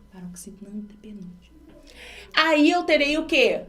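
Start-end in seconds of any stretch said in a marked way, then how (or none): 0.1-1.6 barely audible, hurried whisper; murmured note to self, whispered; whispered aside. Real, not acted. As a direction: whisper it as a quick reminder. Teacher repeats term as an aside.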